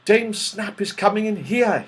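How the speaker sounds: usual speaking voice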